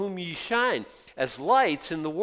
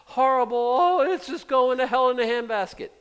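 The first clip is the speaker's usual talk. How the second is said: high, louder hammed-up despair; mock panic; 10 semitones higher, 5 dB louder than usual. Preacher mocks worried believers, high loud panicked wail. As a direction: wail like everything is doomed. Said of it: "Don't get wiped out like".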